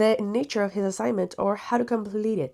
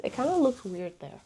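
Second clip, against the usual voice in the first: ordinary talk, nothing marked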